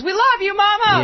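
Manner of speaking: high, loud fan shout; adoring heckler. Retold or imitated. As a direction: yell it like a devoted fan. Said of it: "the only heckle I get is".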